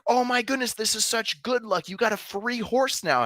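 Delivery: high-pitched, gushing excitement; gushing neighbors. Retold or imitated. Neighbors cooing over the farmer's luck, high and gushing. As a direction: squeal it like a thrilled busybody. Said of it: "and they're like".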